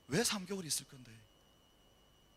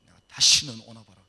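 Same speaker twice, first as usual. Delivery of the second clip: fed-up vow; 4 semitones lower, 14 dB louder than usual. Retold or imitated. Fed-up vow after entry.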